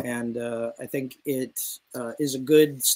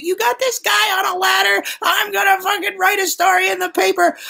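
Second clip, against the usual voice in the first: high, loud mock yell at the boss; retold yell; 8 dB louder than usual. Drunk journalist yelling at the bar boss, loud mock yell. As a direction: bellow it like a drunk bully. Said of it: "he did yell at my boss".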